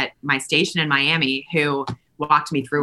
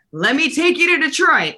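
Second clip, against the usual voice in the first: higher, louder announcer call, lightly done; sportscaster catchphrase; 10 semitones higher, 3 dB louder than usual. Retold or imitated. Host imitating her old boss on air, higher and louder. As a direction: throw to the highlights like a TV sportscaster. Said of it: "and he would be like".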